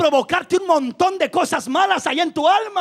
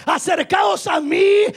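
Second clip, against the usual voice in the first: fiery preaching; 4 semitones higher than usual. Acted. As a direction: preach it with full force to the congregation. Preaching at full force.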